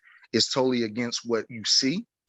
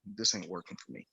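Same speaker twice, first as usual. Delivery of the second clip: quiet self-talk; 7 semitones lower, 14 dB quieter than usual. Retold or imitated. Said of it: "look in the mirror and say".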